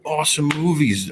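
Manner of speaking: high, eager fanboy voice; starstruck gushing. Retold or imitated. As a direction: gush like a fan. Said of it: "I got all excited".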